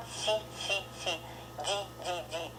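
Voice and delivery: си and ди softly palatalized, each syllable apart; soft syllable drill. Shown as a demonstration. Teacher breaks the word up for learners, soft syllable drill. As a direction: quietly isolate soft си, then soft ди. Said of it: "very soft. The first one".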